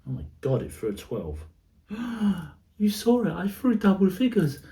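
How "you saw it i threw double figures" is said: high excited gasp and boast; thrilled at a lucky roll. Real, not acted.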